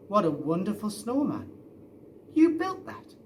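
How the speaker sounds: the dad amazed at his son's snowman; amazed delight. Acted. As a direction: gush with surprise at the snowman.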